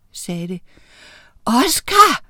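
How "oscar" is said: højt, søgende kald; råb. A narrator's reading. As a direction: kald hans navn i højere tone. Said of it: "råbte Miranda". (råb efter en, der er blevet væk)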